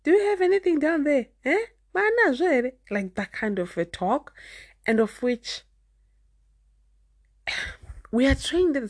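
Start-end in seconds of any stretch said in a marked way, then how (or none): 0.0-2.7 high, quick prying aunties' voice; imitating aunties. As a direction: mimic the nosy aunties, pitched way up and fast. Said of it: "And they're like".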